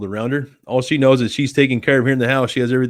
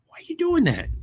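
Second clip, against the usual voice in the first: much higher, softer squeaky pet voice; 4 dB quieter than usual. Real, not acted. Baby-talking the cat.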